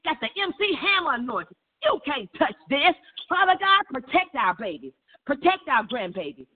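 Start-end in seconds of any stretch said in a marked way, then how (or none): none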